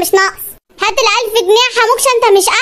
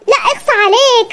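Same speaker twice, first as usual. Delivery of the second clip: ordinary delivery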